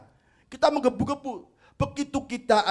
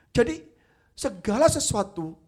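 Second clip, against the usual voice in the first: ordinary talk, nothing marked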